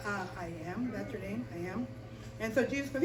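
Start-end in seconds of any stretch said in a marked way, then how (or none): 0.1-1.9 low, quiet, doubtful questioning; softly acted confusion; puzzled Moses. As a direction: mumble it back, unconvinced. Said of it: "And Moses goes".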